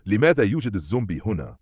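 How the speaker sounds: ordinary delivery, nothing marked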